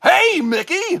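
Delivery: high cartoon goofball call; Goofy. Calling to his mouse pal, goofy cartoon voice. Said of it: "that's my goofy".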